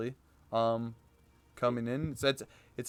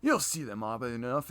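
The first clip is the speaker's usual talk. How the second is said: normal talking voice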